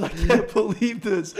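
high, slow disbelief; stunned disbelief. Retold or imitated. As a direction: drag it out, amazed. Friend stunned.

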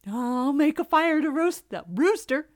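high, loud cub voice; eager little fox. A narrator's reading. Little fox planning to roast rooster, eager. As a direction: burst in with greedy glee.

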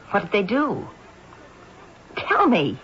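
sharp, urgent demand; snapping. Acted. Estelle to her sister Cora, a mild sharp snap. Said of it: "no need to snap at me".

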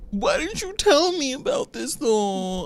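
very high, slow, whiny mock teenager; annoying whine. Imitating Archie complaining to his dad, annoying whine. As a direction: drag it out in a high, irritating whine.

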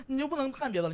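ordinary delivery

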